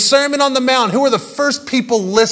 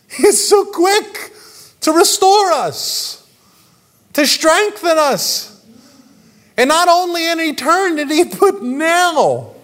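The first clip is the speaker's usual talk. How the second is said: soaring praise; 8 semitones higher than usual. Acted. Preacher building to climax.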